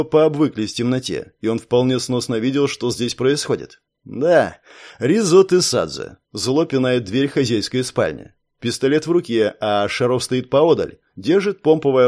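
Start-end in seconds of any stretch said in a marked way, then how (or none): none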